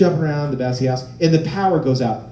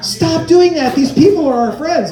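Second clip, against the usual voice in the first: high, loud acted reprimand; scolding spouse; 12 semitones higher, 7 dB louder than usual. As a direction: tell off your partner, exasperated and hammed-up. Spouse scolds him after his joke.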